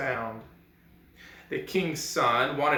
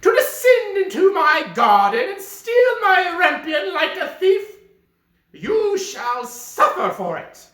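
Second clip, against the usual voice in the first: angry enchantress; 11 dB louder than usual. Acted. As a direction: spit it out like a wrathful witch. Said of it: "said she with an angry look".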